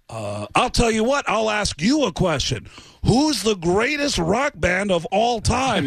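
high, slow, swaggering rock-star quiz. Pretend. Caller posing as [name] quizzing the assistant, slow and swaggering. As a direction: lean into the star act.

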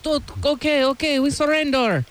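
high, exaggerated French accent, surrendering; mock French accent. Podcast host mocking the French army in a French accent. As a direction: play a cartoon Frenchman giving up. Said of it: "Oh, that's a good accent".

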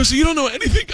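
very high, incredulous question; exasperated dad. Acted. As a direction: act out a dad losing patience. Dad exasperated with clueless daughters.

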